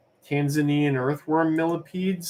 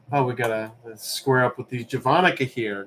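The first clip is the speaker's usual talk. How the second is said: usual speaking voice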